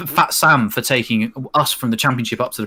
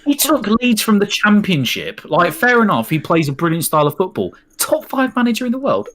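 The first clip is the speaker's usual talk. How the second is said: incredulous rant; 10 semitones higher than usual. Acted. Incredulous rant about Bielsa.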